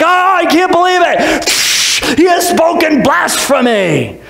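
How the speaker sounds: high, slow, outraged cry of disbelief; the priest aghast at blasphemy; mock horror, drawn out high. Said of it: "They're like".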